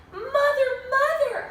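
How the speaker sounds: high urgent call for Mother; dog calling out. Acted. Dog Oliver calling to Mother in alarm, high and loud. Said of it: "Oliver barked".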